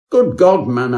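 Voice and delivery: high, loud startled exclamation; jolted awake by icy feet; shocked gentleman's cry; startled outburst. A narrator's reading. Startled by cold feet in bed.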